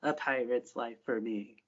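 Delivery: slow, solemn pirate oath; drawn out and grave; slow solemn vow. Acted. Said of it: "repeats the same phrase".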